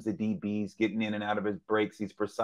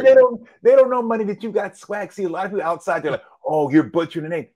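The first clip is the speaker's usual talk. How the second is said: fired-up defense; 10 semitones higher, 8 dB louder than usual. Real, not acted. Cohost hyped defending swagger.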